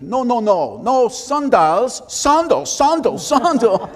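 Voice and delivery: put-on thick German accent, loud and high-pitched; exasperated German-accented shouting, each word stretched out for comic effect; Einstein. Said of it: "a very thick German accent".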